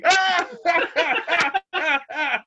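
high, long screeching laugh; mock helpless laugh. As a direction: imitate yourself cracking up helplessly. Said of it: "It's just like me".

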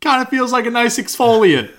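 high, forced cheerful brush-off; fake brave cheer. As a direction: pretend it doesn't hurt at all. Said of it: "and I'm like".

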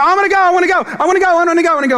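put-on high, loud, excited kid begging to come; eager kid. Son rushing downstairs, begging to go, high and excited. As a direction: bounce with eagerness like a little boy. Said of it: "And he was like".

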